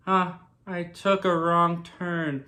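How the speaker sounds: slow, spaced-out trance voice; dazed trance. Retold or imitated. Agnes stuck at a stop sign, answering Vision in a slow daze. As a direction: drift through it slowly, as if in a trance. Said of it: "weird kinda trance where she was just sitting there".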